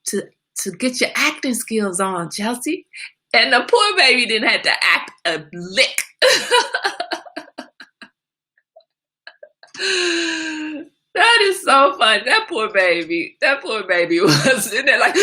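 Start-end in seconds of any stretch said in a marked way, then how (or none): 3.3-15.2 loud, high, giggling through the words; laughing too hard to finish. Real, not acted. Mother laughing about daughter's hospital visit, cracking up. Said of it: "you understand the laughter".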